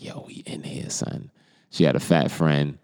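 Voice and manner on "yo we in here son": whispered brag, no voice at all; retold whisper. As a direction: hush it like your friend mustn't be overheard. Bragging to his boy at the crib.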